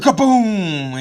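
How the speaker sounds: loud, slow kaboom; drawn-out comic kaboom; mock explosion. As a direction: belt out an explosion noise. Sound effect of a shot.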